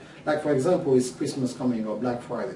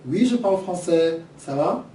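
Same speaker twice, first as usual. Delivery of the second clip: lively French, pitched up and louder; speaking French; 5 semitones higher, 5 dB louder than usual. Shown as a demonstration. Teacher jokingly slips into French for listeners, speaking French. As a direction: switch into French, higher and brisk. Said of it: "Do you speak French?".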